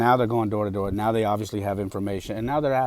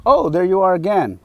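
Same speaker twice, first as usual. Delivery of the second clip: imitated canvasser, higher and louder; mock cheery greeting; 9 semitones higher, 8 dB louder than usual. Canvasser spots him at his door, mock cheery greeting. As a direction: sing out a retold greeting, pitched up.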